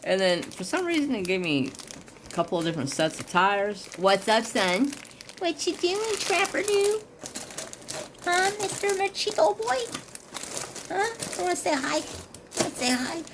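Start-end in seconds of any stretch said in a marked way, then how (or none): 4.0-13.2 much higher, sing-song pet voice. Real, not acted. Cooing to his dog.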